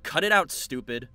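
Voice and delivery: loud, slow angry rebuke. Acted. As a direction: snap at her to stop. Grabs her arm angrily.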